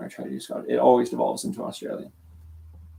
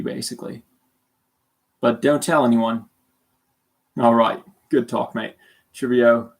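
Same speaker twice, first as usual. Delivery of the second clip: mock British accent turned Australian; put-on Australian accent. Confessing the lie to a new classmate, put-on Australian accent. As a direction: play the fake Aussie newcomer. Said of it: "It would go like that".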